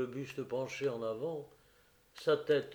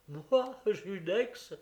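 astonished exclaim; 7 semitones higher, 3 dB louder, much slower than usual. Acted. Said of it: "s'exclama l'infortuné Prosper".

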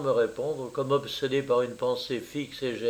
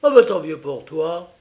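loud, sore-loser retort; sulky rage; 3 semitones higher, 8 dB louder than usual. A narrator's reading. Rejected friend answers the chosen one, sulkily enraged. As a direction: throw out the congratulation crossly. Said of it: "Il déclara d'un ton rageur".

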